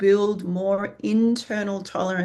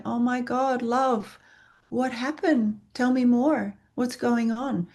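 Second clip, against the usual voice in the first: tender concern; 4 semitones higher than usual. Retold or imitated. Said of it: "you're going".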